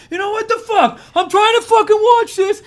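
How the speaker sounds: high, loud mock rage at his girlfriend; hammed-up angry yelling while trying to watch the game; mock angry outburst. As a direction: blow up like a fed-up boyfriend.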